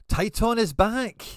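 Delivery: Titan is back, shouted in triumph; triumphant announcement. Real, not acted. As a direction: boom it out like a hype announcer. Hyped announcement of player return.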